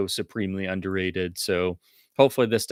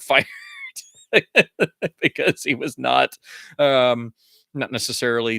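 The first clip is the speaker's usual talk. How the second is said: laughing; 5 semitones higher, 5 dB louder than usual. Real, not acted. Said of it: "laughing at the story".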